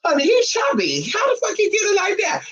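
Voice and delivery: a high, stunned exclaim; shocked. Retold or imitated. Seeing a man in Speedos, mock shocked and high. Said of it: "I was, like, shocked".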